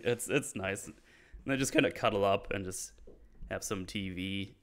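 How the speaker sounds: ordinary talk, nothing marked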